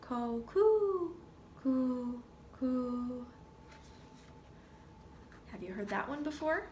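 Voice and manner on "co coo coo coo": gentle dove call; dove coo. Shown as a demonstration. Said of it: "Morning doves take their morning stroll".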